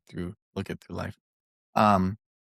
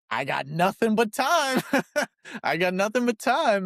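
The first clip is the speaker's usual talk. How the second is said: mock carefree procrastinator; 9 semitones higher than usual. Procrastinator told he will live forever, mock carefree. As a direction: play it hammed-up and unhurried, pitched high. Acting out procrastination with endless time.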